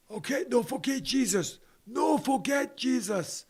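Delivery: high, slow sing-song preaching; mock preacher. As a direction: preach it like a televangelist.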